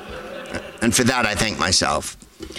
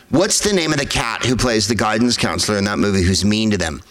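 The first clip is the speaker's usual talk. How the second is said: usual speaking voice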